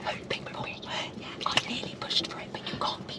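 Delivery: hushed whisper. Real, not acted. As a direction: breathe it, no voice. Confiding aside, unvoiced.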